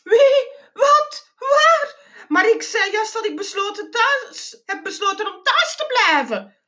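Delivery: very high panicky squeal; high-pitched flustered alarm; worried squeak. A narrator's reading. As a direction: squeak out alarmed questions, then protest in a high voice. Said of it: "piepte ik bezorgd".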